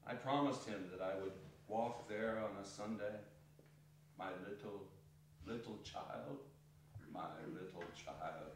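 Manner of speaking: low, soft, breaking grief; grieving father. Acted. Bob mourning his little son to his wife, low soft grief. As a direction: let the words sink under quiet sorrow.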